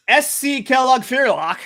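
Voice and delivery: exaggerated Austrian accent on the team name; mock Austrian accent. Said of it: "I feel like your accent got better".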